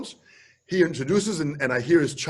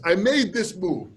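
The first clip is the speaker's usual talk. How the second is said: ordinary talk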